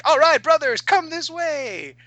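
very high, loud kobold chirp that slows and stretches on "come this way"; a little monster happily waving its brothers over; high yappy kobold greeting fellow cultists; squeaky kobold. Acted. Kobolds welcome disguised heroes as cultists.

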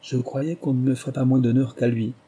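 ordinary delivery, nothing marked

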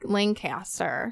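"Lancaster" with a full CAS in the middle, slow; alternate pronunciation. Shown as a demonstration. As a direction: say "Lancaster" slowly and softly as LAN-CAS-ter. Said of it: "sometimes it's Lancaster or".

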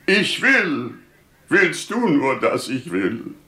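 high-pitched fervent call; impassioned vow, pitched high then falling; crying out to God. Acted. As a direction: call up to heaven with resolve. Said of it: "Ich stand und rief zu Gott".

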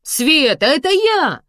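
high, loud cry through the door; shouting. A narrator's reading. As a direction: yell to be recognised. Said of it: "закричала мама своим голосом".

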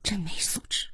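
whispered disdain; hushed aside, very soft; low disapproving murmur. A narrator's reading. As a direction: whisper it snidely about someone nearby. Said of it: "低低地说道".

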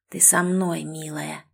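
low, soft mutter, fading to almost nothing. Acted. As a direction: murmur it close and possessive. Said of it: "пробормотал мужчина". (тихое бормотание)

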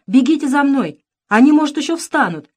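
high, loud urgent warning; calling out. A narrator's reading. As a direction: call them to follow, alarmed. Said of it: "звала она". (тревожно зовёт за собой)

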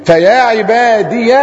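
slow "عبادي" ending in a clear "-diya"; ya made audible. Shown as a demonstration. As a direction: stretch "عبادي" and voice its final ya. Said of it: "خلصت كده الإضافة مع الياء أهي". (إظهار الياء ببطء)

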